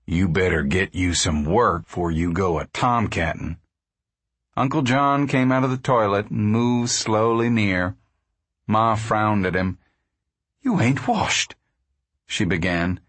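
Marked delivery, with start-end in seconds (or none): 0.1-3.6 low, stern father. A narrator's reading. Pa warning his son off tomcatting, stern. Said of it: "Pa said sternly". 10.6-11.5 high, lightly voiced scolding; a touch of reproach, pitched up; scolding mother. A narrator's reading. Ma frowning at unwashed Uncle John, mildly scolding.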